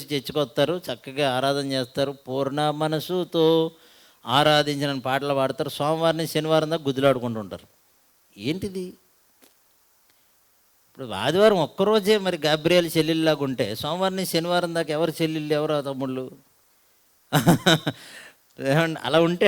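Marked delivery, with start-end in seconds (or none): none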